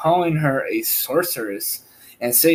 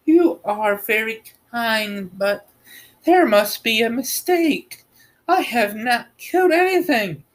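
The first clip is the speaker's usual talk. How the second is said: a hint of a young girl, raised pitch; lightly voiced Dorothy; 7 semitones higher than usual. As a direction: speak as a hesitant little girl. Dorothy's reply.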